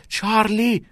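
very high yell of the name; high-pitched shouted call; alarmed shout. A narrator's reading. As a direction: cry out to stop a friend. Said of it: "ناکس داد زد".

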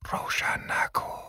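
slow, breath-only whisper. A narrator's reading. Mrs. Marsten hot-breathed in Dalen's ear, whispering. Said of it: "خانم مارستن زمزمه کرد".

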